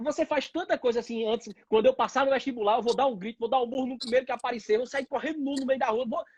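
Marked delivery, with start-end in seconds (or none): none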